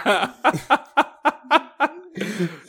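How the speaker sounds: high cackling laugh like a witch; witch cackle. Pretend. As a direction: let out an evil witch laugh. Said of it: "C'est mon rire de sorcière".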